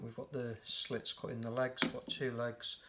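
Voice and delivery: ordinary delivery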